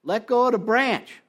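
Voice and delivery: a cheerful order from the sky; god telling the drunk to let go; voice from above. Acted. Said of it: "the voice says".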